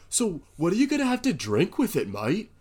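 high, loud, hammed-up Australian; eccentric Aussie accent. Counter guy asking a customer about drinks, eccentric Aussie accent. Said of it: "most eccentric Aussie I've ever met".

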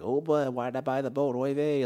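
put-on light Yiddish accent, old man. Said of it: "Judd Hirsch, like".

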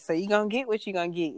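usual speaking voice

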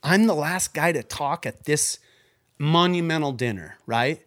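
overwhelmed at being picked last; shocked. Retold or imitated. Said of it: "And I go".

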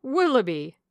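hearty loud exclamation; exclaiming. A narrator's reading. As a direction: burst out with the name. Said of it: "cried Sir John".

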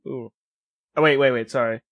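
ordinary talk, nothing marked

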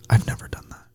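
hushed aside whisper. Real, not acted. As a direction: whisper it to yourself. Aside confession, unvoiced.

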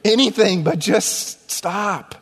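acted exasperated plea; desperate plea. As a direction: plead for it to end, drawing the words out. Acts out wish to end crying.

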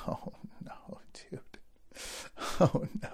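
hushed mock dread; retold sinking dread under the breath; dreading whisper. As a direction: whisper it like a kid caught out. Boy dreading being made to confess.

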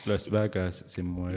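slow, low nature documentary voice; lightly voiced wildlife narrator. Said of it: "Tipo Nat Geo".

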